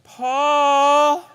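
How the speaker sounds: high, loud call into the dark; calling for a lost cat; searching shout for the cat; yelling out. Retold or imitated. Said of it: "and I yelled".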